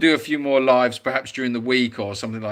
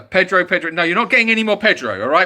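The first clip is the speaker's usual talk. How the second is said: louder, higher playful scolding; mock stern telling-off; 5 semitones higher, 5 dB louder than usual. Teasing the viewers who keep asking about Pedro, mock stern. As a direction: wag a finger at the chat, half joking.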